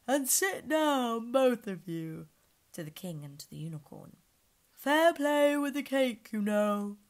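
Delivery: slow, loud lion commands. Acted. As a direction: give lazy orders slowly. Lion orders others.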